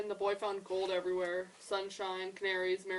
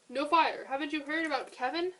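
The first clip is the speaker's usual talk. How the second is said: high, loud urgent news; excited outburst; 7 semitones higher, 7 dB louder than usual. A narrator's reading. Animal bursts in on Toucan with news, high and loud. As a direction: blurt the big news.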